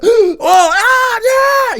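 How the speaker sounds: high, loud screaming cheer; crowd cheer. Retold or imitated. Fan imitating a cheering theater crowd, high loud scream. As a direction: cheer like a packed opening night. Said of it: "theater with everybody kinda".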